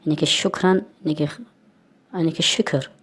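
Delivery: ordinary talk, nothing marked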